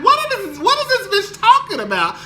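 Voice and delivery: mock disbelief. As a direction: sound baffled by what she hears. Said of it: "at first I was like".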